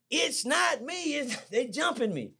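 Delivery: high, slow pleading; pleading denial. Retold or imitated. Young man pleading with the family that it was not him, high and slow. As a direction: beg them to believe you.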